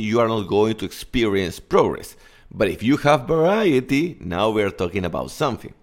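ordinary delivery

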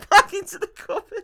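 very high, loud helpless squeal; laughing hard. Real, not acted. Teller explaining the absurd exit to co-host, laughing hard. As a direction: squeal it through real laughter. Teller cracks up retelling film.

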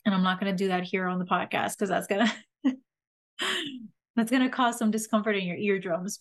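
ordinary delivery

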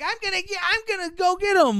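put-on high-pitched determined kid's voice; hammy, rushing-to-the-rescue cry; Eric. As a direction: put on a frantic boy, voice way up. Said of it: "then Eric starts".

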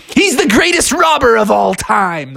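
high-pitched dramatic announcement; excited exclamation. Acted. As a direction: exclaim it like a big reveal. Bears exclaim over the culprit.

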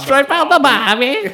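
very high, loud, drawn-out mock Australian; over-the-top Aussie holler; Australian accent. Shown as a demonstration. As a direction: shriek it in a hammed-up Australian accent. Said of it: "give us your best Australian".

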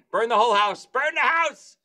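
building to a high mock yell; escalate, pitch climbing on the repeat; mock frantic urging. Wife on the lawn wanting it burned, mock frantic.

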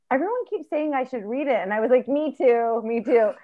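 ordinary delivery

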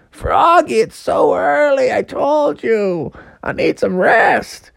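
high whining mother frog; complaining mom. Acted. Mom wanting rest scolds Froggy, complaining. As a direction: start high and sag wearily, stretching words.

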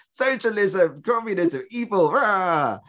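high-pitched, hammed-up denunciation; mock villainous rant. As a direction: rail in mock horror, ending in a roar. Mock rant against communism.

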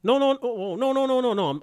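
high, rapid string of no's; emphatic denial. Real, not acted. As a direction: protest high and loud. Protesting correction, high pitched.

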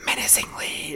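voiceless imitation of menacing whispers; hushed, sinister whispering in imitation. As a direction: whisper it like an eerie echo. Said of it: "starts whispering, like, really menacingly".

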